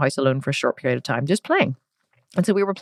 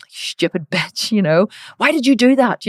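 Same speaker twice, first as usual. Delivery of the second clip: slow then louder, higher snapping parent; mock angry parent; 5 semitones higher, 3 dB louder than usual. Parent scolding a child over a broken thing, mock angry and louder. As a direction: snap at the child in mock anger. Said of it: "You go".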